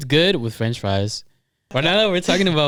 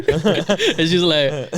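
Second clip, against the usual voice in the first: giggling through the words, slow; can barely talk for laughing; 4 semitones higher, much slower than usual. Real, not acted. Cracking up retelling corn dog.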